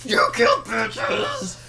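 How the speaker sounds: high, slow hammed-up lament; overdone grief over the cow; mock dramatic wail. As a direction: stretch it out in mock tragedy.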